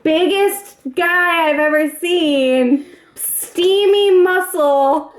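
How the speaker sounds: ordinary delivery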